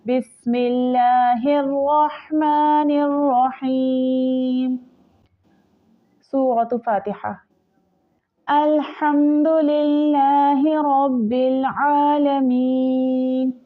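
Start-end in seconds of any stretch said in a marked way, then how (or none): 0.0-4.8 the ह in रहमानिर breathed from the throat; throaty ha. Shown as a demonstration. Teacher showing the throat ha in the basmala, slow chant. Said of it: "हा हलक से अदा हो". 8.5-13.5 slow melodic tajweed chant; Quranic recitation. Shown as a demonstration. Teacher demonstrating the recitation to students, slow melodic chant.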